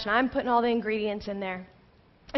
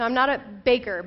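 normal talking voice

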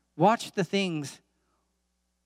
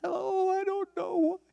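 high, drawn-out wail of helpless distress; mock distressed woman; slower than usual. Preacher imitating a distressed lady at the gas pump, mock distress. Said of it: "she was so distressed".

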